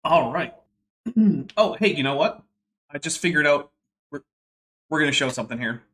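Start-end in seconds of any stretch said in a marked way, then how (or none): none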